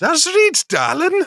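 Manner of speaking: hammed-up high Durham voice; Durham accent. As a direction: squeak it out in a mock Durham accent. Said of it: "trying to do it, no run up".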